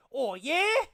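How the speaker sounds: loud, high bogan Australian exclamation; bogan Australian accent. Pretend. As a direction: lay the Aussie on thick and loud. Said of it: "a very bogan Australian accent".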